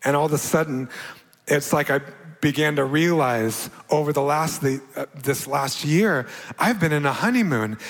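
ordinary talk, nothing marked

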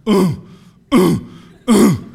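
short loud grunts, spaced apart; repeated grunts. Acted. Showing shy students how to invite, repeated grunts. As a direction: grunt once for each drop, abruptly. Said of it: "comes up to you and grunts".

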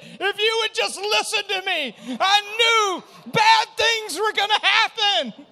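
high, loud told-you-so shout from the daughter, slowing at the end; daughter's scolding shout. Retold or imitated. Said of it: "She said".

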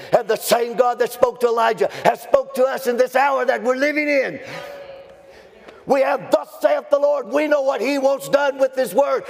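high, ringing preacher's shout; full-force preaching. Real, not acted. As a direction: preach it high and forceful, driving every phrase.

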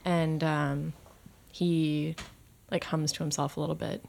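ordinary talk, nothing marked

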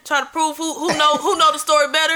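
ordinary delivery

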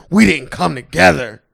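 loud, slow mock complaint; mock mad. Imagined girlfriend mad at her man in bed, mock angry complaint. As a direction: imitate her drawn-out, angry grievance. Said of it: "then she get mad at you".

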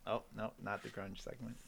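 normal talking voice

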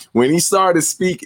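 ordinary talk

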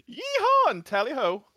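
high, slow cowboy-meets-Brit cheer; mock Texan British mashup; yee-haw drawled, tally-ho posh; half twang. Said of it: "got, like, a half twang".

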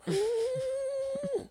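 shrill burst of laughter; high squealing laugh. Real, not acted. Laughs after dog voice.

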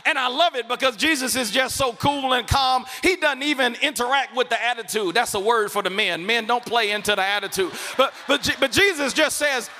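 loud, rising preacher's build; full-force preaching. Acted. Pastor preaching Jesus's answer to Martha at full force. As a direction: drive the point home hard.

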